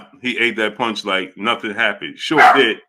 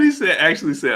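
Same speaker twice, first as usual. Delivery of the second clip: ordinary talk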